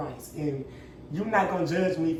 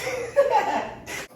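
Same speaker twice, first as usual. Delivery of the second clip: high laugh. Real, not acted. Laughing at own mistake.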